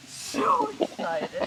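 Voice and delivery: high, slow cooing. Real, not acted. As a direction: stretch it out to soothe her. Cooing to excited pig.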